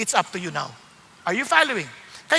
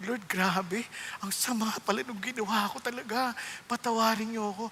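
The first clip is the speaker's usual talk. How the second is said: very soft, low confession; retold penitent prayer; 3 semitones lower, 13 dB quieter than usual. Preacher retells confessing to the Lord over a sin, quietly penitent. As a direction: murmur a humbled plea to God.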